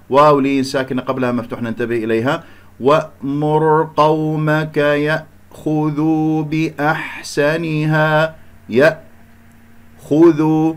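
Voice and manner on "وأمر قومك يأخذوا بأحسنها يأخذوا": وأمر قومك يأخذوا slowly chanted, each ء closed clean; unbounced hamza. Shown as a demonstration. Teacher reciting slowly for students, measured chant with clean stopped hamza in يأخذوا. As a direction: draw out the syllables, stop firmly on ء in يأخذوا. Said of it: "لا أقلقل الهمزة".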